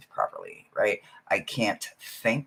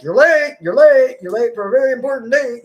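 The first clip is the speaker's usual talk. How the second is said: much higher, louder singsong fast "you're late"; 12 semitones higher, 9 dB louder than usual. Retold or imitated. Mosaic hurrying the reader along, high rabbit singsong. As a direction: chant it like the frantic rabbit. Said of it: "Mosaic's like".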